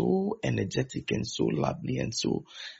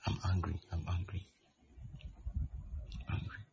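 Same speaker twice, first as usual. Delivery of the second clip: mock sulk, fading softer each time; sulky muttering; 3 semitones lower, 11 dB quieter, faster than usual. Offended church member sulking at pastor, softer each time. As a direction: sulk it out, trailing off to almost nothing.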